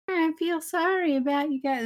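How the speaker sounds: high-pitched mock pity; mock sympathy. Podcaster imitating Kirk pitying the stranded crew, mock sympathy. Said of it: "Kirk's been all like".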